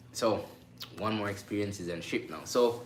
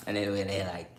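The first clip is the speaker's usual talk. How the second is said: usual speaking voice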